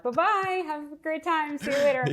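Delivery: high, sing-song mock-chipper farewell; cheery goodbye. Mom gleefully leaving her kids behind, high sing-song goodbye. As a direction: wave the kids off with bright glee.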